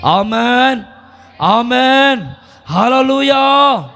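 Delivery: rising, ringing amen and hallelujah; drawn-out hallelujah over music; preaching acclamation. Real, not acted. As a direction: call the congregation to praise. Opening acclamation of sermon.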